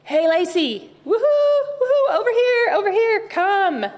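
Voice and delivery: high, loud cheerful call across a room; playful calling out, loud and high. Pretend. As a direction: shout out to her like you're waving her over. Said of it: "I feel like this should be like".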